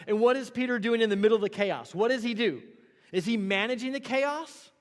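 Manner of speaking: loud, high, driving sermon climax; building to a near shout; full-force preaching. Acted. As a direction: preach it out over the chaos.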